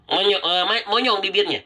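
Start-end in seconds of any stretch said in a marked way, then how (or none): none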